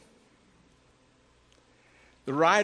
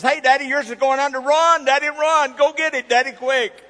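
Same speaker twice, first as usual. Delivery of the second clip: put-on high, loud, fast little girl's alarm; urgent shout warning dad his line is going under; frantic child; 10 semitones higher, 9 dB louder than usual. Daughter telling dad his cork sinks, loud and rushed. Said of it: "the other one says".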